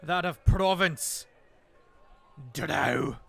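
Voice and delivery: loud, curt dwarf bark; booming retort. Acted. Dwarf lord barking back at the drow, booming. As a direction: snap the answer back across the dock.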